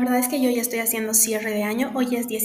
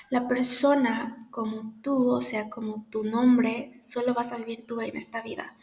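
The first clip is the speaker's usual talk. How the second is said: ordinary delivery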